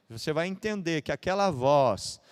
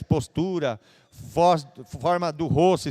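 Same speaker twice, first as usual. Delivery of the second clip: ordinary talk, nothing marked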